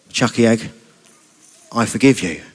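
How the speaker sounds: low, subdued forgiveness; understated acted sadness, low pitch; a little sad. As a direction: offer it gently, with a touch of sadness. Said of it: "Sheldon looks a little bit sad".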